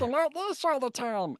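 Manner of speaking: very high put-on cartoon voice; Pidge. Showing the co-hosts an actor's cartoon role, very high voice. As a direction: squeeze it up into a high character voice. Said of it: "he also did Pidge".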